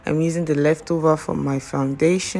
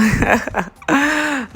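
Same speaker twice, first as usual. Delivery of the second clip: laugh; 11 semitones higher, 4 dB louder than usual. Real, not acted. Laughing at own voiceover.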